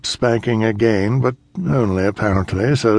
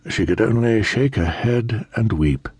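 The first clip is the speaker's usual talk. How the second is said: usual speaking voice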